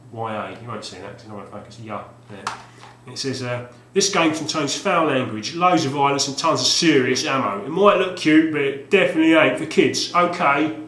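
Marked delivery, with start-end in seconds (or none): 3.9-10.8 loud, higher tough-guy read; hammed-up tough blurb. Reading the back of the game box in tough ad voice. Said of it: "it might take a bit of acting".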